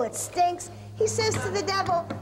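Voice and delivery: normal talking voice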